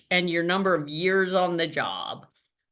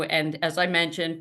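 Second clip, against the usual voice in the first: ordinary talk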